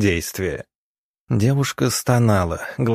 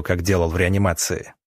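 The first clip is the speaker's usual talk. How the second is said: usual speaking voice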